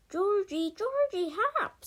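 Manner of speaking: very high, loud desperate call; calling Georgie from the river; cry for help. A narrator's reading. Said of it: "Sage cried out suddenly".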